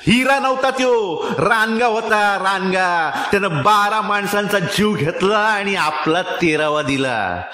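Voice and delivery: high, angry, anguished shouting; loud helpless outcry. A narrator's reading. Boatman confronting the dead man's old mother, shouting helplessly. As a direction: cry out in exasperated fury. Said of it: "अगतिक होऊन ... मोठ्यानं ओरडला".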